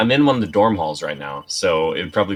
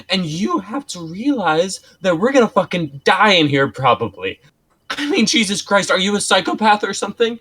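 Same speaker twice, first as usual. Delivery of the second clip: acted panic; 6 semitones higher, 5 dB louder than usual. Acted panicked confrontation in script.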